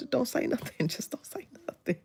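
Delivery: soft, dropping into a whisper; hushed warning. Pretend. Telling a fellow hostage to stay quiet, hushed mock warning. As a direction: pretend to shush someone, fading to a whisper. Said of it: "I would be so quiet".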